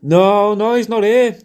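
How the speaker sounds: high, loud mock shout; shouting back. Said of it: "You, you can shout, going".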